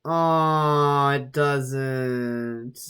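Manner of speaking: long drawn-out sigh of dismay; disappointed. Real, not acted. Reader finding the old petition site gone, disappointed. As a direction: stretch the words out in letdown.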